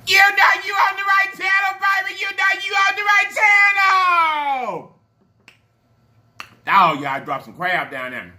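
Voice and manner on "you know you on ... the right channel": very high sustained yell; shouted catchphrase. Real, not acted. Vlogger hollers the channel catchphrase to viewers, celebratory high shout. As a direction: holler it on one high held note, stretching the ending.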